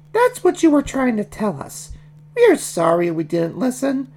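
high falsetto piggy voice; high-pitched, sorry character voice; apologetic baby Piggy. Acted. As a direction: apologise as a squeaky little pig.

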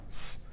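s as a bare hiss; English S demo. Shown as a demonstration. Teacher comparing English sounds, demonstrating s. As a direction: make only the consonant s. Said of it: "and S sound".

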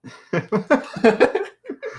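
high loud laughing burst; sudden high cackle; burst of laughter. Real, not acted. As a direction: crack up loudly.

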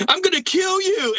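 high, yelled retold threat; mock threat. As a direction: play your younger self picking a fight. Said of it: "and I was like".

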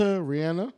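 Rihanna with the short a of "cat"; Rihanna, middle syllable rhyming with "can"; pronunciation shown. Shown as a demonstration. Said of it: "it's pronounced Rihanna".

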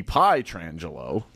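Pietrangelo as pie-TRAN-juh-lo; another possible pronunciation. Shown as a demonstration. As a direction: open Pietrangelo like the word pie. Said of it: "Could be".

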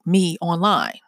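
ordinary delivery

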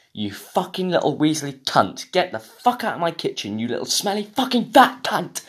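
angry rant climbing high in pitch; Gordon Ramsay. Retold or imitated. Podcaster imitating chef abusing a cook, mock furious. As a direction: build from irritated to an outraged kitchen tirade. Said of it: "I want Gordon going up to some person and saying".

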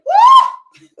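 high, loud whoop; preacher's whoop. Real, not acted. Preacher whooping at the congregation. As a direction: let out a joyful woo.

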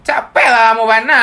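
loud, high Padang Minang grandpa; Padang accent. Retold or imitated. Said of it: "Udah bahasa Padang gitu". (logat Padang keras dan tinggi)

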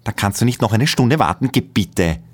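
brushing him off; snippy. A narrator's reading. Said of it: "antwortet nur schnippisch". (schnippisch, höher)

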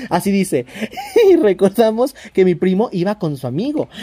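ordinary delivery

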